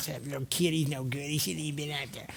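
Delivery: low, quiet disgruntled grumble; imitated bleacher grumbling, kept low; grumbling parents. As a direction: grumble under your breath like annoyed spectators. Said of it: "he hears all the parents grumbling".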